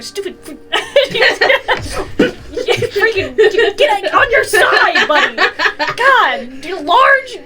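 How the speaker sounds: high, slow strained griping; irritated laughing rant. Acted. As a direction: grumble at him while laughing. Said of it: "you hear it also in the back".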